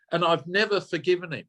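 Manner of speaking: ordinary delivery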